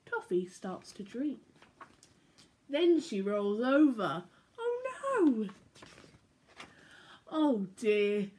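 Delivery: bright, lilting storybook voice; reading to children. A narrator's reading. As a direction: read it like bedtime story time for little ones. Reading picture book aloud.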